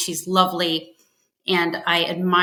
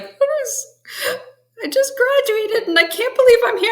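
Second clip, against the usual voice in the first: wailing through tears, pitched way up; mock crying to a stranger in an elevator, pitched way up; imitating bawling. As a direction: retell it like you're sobbing again, voice jumping high. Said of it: "And I was just bawling".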